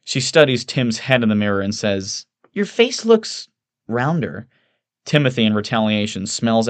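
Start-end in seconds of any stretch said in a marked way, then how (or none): 2.5-4.5 high, slow, drawn-out observation; needling Evelyn. Acted. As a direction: dwell on it in a high voice.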